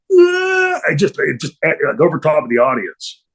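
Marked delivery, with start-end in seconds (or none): 0.1-0.8 high-pitched imitated scream; brief high mock shriek; mock curdling scream. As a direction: mimic a distant scream of agony, held on one note. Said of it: "these ungodly curdling screams".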